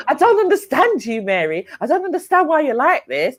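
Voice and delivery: high-pitched imitation of her protest; mimicked complaint. Mimics the woman they argued with.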